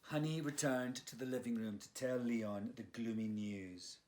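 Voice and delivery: plain reading voice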